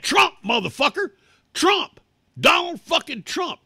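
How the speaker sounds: loud, high, punchy yelling; defiant shouted reply. Retold or imitated. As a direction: shout the answer back defiantly. Acts out his defiant texted answer.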